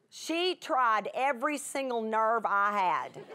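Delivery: normal talking voice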